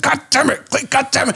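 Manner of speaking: high-pitched cursing, no added volume; mock exasperation. Friend cursing as he cleans throw up, mock exasperation.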